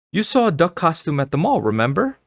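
high-pitched mother voice, prompting; eager mother. Acted.

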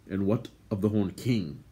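ordinary delivery, nothing marked

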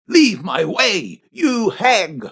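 drawn-out command, not shouted; angry cry. A narrator's reading. Priest raises his hand to strike her, slow angry cry. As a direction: order her aside, slowly and forcefully. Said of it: "cried the priest".

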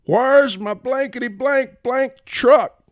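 high, slow, outraged bellow; defiant booming voice. A narrator's reading. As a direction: demand it like a defiant union boss. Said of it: "in a voice like John L. Lewis defying the Supreme Court".

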